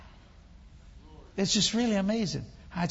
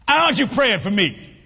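louder, higher acted demand; retold loud demand; 4 semitones higher, 10 dB louder than usual. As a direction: demand it forcefully in a raised voice.

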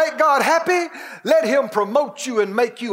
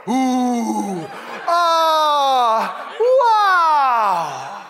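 awed oohs and aahs; much slower than usual. Acted. Preacher imitating believers marvelling in eternity, awestruck. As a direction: swell from ooh to a big amazed wow. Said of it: "we'll go".

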